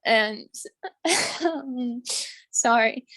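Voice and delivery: tearful break with an embarrassed laugh; real, emotional. Real, not acted. Student apologising to class mid-testimony, tearfully emotional. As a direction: choke up and apologise through it. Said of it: "why I'm getting emotional".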